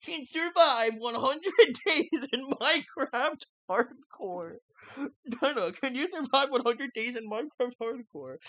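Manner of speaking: clickbait video narrator. Retold or imitated. Mocking a YouTuber intro.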